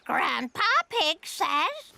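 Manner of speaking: talking-bird voice; parrot mimicry. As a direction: repeat it by rote like a parrot. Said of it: "I can teach Polly what to say".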